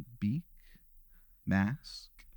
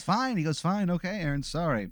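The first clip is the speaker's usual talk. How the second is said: plague doctor goes high and huffy; high huffy surrender; 7 semitones higher than usual. Acted. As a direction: give in with a high, put-out fine. Plague doctor gives up, leaves.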